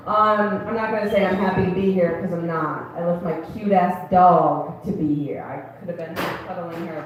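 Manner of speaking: ordinary delivery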